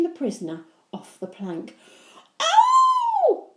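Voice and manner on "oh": high frightened shriek; a loud shrill cry. Acted. Peter pushed off the plank by Henry, shrieking. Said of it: "shrieked Peter".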